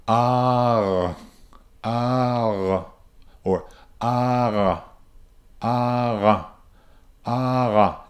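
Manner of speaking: ah drifting toward an R at the end; wrong ah that changes. Shown as a demonstration. Teacher shows the ah that should not change. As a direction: let the ah slide into R, the wrong way. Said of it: "I don't want to hear".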